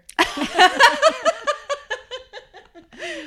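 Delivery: a high, loud shriek of laughter; real burst of laughter, way up high. Real, not acted. Laughing out loud as a friend names Brenda Fricker.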